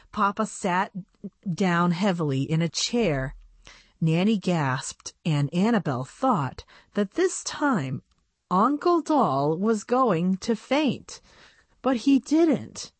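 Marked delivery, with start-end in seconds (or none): none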